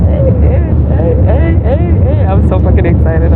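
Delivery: ordinary talk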